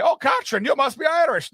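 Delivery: put-on high Irish-accented flirt; Irish guy. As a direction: charm her like a pub regular. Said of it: "one Irish guy".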